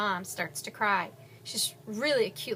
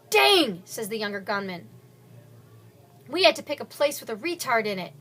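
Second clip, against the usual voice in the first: annoyed; 7 dB louder than usual. Acted. Younger gunman complains.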